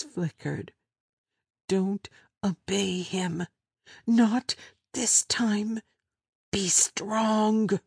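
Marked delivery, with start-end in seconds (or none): 1.7-7.8 high, slow self-encouragement; frightened woman urging herself to be strong; inner voice resolve. Acted. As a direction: steel yourself inwardly, higher.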